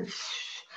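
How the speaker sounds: a long unvoiced sigh; weary sigh. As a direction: blow out a tired sigh. Acted sigh in the demo.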